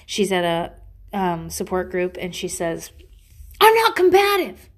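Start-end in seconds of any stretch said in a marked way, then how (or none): 3.6-4.7 high, loud defensive yell of the wrong word; wrong line, yelled. Retold or imitated. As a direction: yell it the wrong way, saying "combative". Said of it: "Or, "I'm not," oh, that's what she says. I'm sorry. That's not right".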